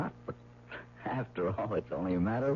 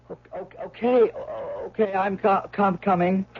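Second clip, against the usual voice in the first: stuttering, then calling out louder and higher; nervous stammer; 11 semitones higher, 8 dB louder, slower than usual. Acted. Husband stammers a frightened reply to the visitor at the door. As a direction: trips over every word while calling to the door.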